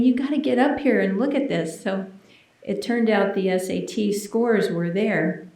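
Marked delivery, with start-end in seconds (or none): 0.0-1.8 excited friends. Retold or imitated. Friends calling her to blackboard.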